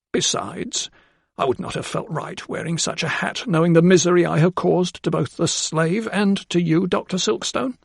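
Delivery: high, earnest woman's voice; a simple maid voicing her conscience; earnest housekeeper. A narrator's reading. Housekeeper explains to the doctor.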